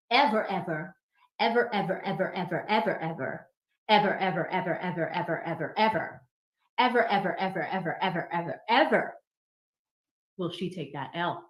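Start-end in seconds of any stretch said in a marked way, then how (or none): 0.1-9.1 comic repetition. Acted. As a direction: rattle "ever" off in rhythmic bursts. Comic endless repetition of one word.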